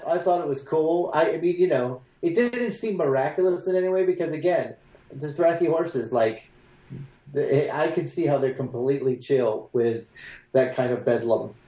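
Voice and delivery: ordinary talk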